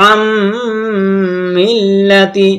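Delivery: "আম" ends in a long nasal hum before "মিল্লাতি"; nasal hold. Shown as a demonstration. Teacher showing the nasal rule, m of "আম" held long. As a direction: hum the m of "আম" long through the nose. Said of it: "পরে আমরা নাকে আওয়াজ নেব".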